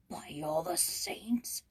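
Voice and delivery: slow, breathless whispered oath; astonished mutter. Acted. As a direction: gasp it under the breath in awe. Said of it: "muttered Broussard".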